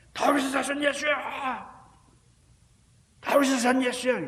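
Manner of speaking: loud, high cry that won't be silenced; beggar's stubborn cry for help; stubborn shout. Retold or imitated. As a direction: keep shouting over the crowd. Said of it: "이 바디메오는 막무가내입니다".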